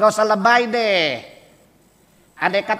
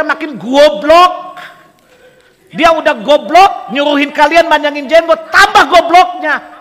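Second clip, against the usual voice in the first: loud, rising harangue, much higher; heated challenge; 7 semitones higher, 9 dB louder than usual. Acted. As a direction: hammer each line at the crowd, building louder. Preacher voices a heated challenge. (tantangan keras dan berapi-api)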